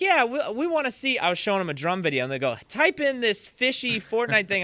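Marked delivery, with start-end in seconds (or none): 0.0-1.2 much higher, eager mock child voice; clamor like a keen youngster; imitated excited kid. Camp kids' demand, voiced. 2.7-4.6 high-pitched mock child demand; imitated eager kid. Camp kids pestering, voiced.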